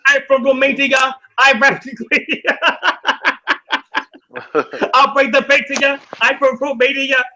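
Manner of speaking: hammed-up Romanian accent with a laugh in the middle; playful impression of a Romanian rapper. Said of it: "[name] would be like".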